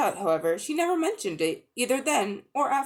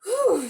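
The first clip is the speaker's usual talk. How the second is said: high, loud sigh of relief; relieved Polly's whew; 12 semitones higher, 5 dB louder than usual. A narrator's reading. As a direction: blow out a breath of relief. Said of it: "laying out a breath of relief".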